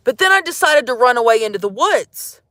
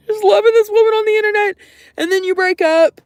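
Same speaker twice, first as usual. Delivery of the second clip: much higher, louder and fast, like a squeal; overwrought outburst; 10 semitones higher, 3 dB louder, faster than usual. Real, not acted. Venting to the stream about the breakup, a high exasperated squeal. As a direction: burst out in a high, fast rush. Repeats the line, then heightens it.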